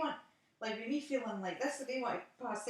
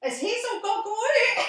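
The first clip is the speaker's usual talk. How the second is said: much higher and louder, a cheeky shout; calling out loud; 11 semitones higher, 10 dB louder than usual. Real, not acted. Teasing the friend on the phone, loud and high. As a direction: call it out across the room.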